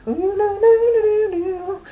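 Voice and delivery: very high-pitched mock squeal; mocking girly babble. Imitating girls chattering on playgrounds, silly high squeaky babble.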